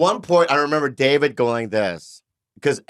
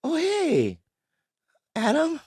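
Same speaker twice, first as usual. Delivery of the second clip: high, soft startled greeting; surprised high greeting; 13 semitones higher, 5 dB quieter, much slower than usual. Retold or imitated. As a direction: act caught off guard and nervous. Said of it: "And I go".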